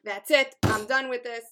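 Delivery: loud, emphatic resolve; fired up. Acted. Listener vowing to stop over-apologizing, fired up. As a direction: declare it with force, fed up. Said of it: "fired up with a passion".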